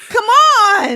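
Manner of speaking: high, loud mock-pleading shout; hammed-up high exclamation; theatrical cry. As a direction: cry it out like a stage ham. Hammed-up theatrical plea after apology.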